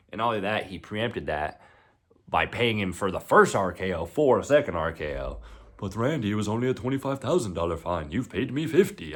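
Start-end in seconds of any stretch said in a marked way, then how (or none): 5.8-9.2 put-on a weak, half-hearted Australian accent; put-on Australian accent, a bit higher and louder; attempted Australian accent. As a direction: try an Australian accent and miss it. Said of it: "I can't do an Australian accent".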